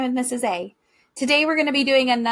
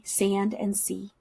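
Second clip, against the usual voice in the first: plain reading voice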